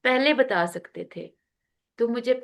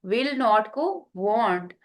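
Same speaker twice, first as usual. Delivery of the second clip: ordinary talk, nothing marked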